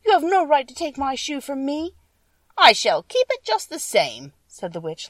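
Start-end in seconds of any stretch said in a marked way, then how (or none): 0.1-2.0 put-on high girl's voice, loud and hurt; indignant protest. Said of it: "cried Dorothy". 2.6-4.3 slow, loud, taunting witch voice; witch mocking. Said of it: "laughing at her".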